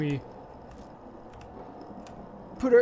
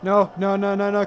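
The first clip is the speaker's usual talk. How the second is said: panicking gamer; 5 semitones higher, 7 dB louder than usual. Real, not acted. Monster appears, panic.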